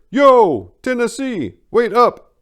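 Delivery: high, loud shout from a distance; calling out. Acted. Groundskeeper calls after Tennessee, loud shout. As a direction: call after him down the street. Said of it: "and I heard".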